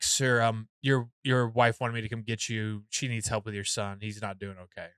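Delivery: discreet manager. Retold or imitated. Manager fetches father for his sick son, low discreet tone. Voices restaurant manager breaking bad news.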